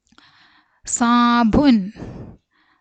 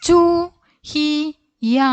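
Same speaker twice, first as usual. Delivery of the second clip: ചുഹിയ drawn out slowly and clearly; slow drill; 4 semitones higher, slower than usual. Shown as a demonstration. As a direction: spell ചുഹിയ out slowly for children. Teacher slow model word. (ചുഹിയ പതുക്കെ നീട്ടി ഉച്ചരിക്കുന്നു)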